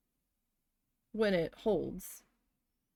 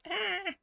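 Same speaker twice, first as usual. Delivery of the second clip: pained yelp; 12 semitones higher than usual. Real, not acted. Burned by the glue.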